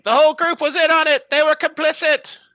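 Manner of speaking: very high, loud, fast accusing yell; yelling Ewan. Acted. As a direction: shout it shrilly after the departing group. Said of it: "was yelling".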